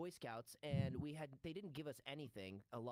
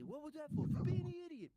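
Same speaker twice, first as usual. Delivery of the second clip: very high, louder mob voices; sketch exchange; 14 semitones higher, 10 dB louder than usual. Retold or imitated. Final take of the sketch, very high and louder. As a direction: fire both lines in high gangster voice. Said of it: "I hit him".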